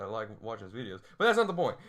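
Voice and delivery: usual speaking voice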